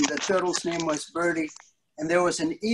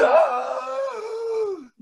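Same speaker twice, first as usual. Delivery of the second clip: big high theatrical gasp; mock gasp. Storyteller gasping in mock horror at the kids. Shocked by brother being three.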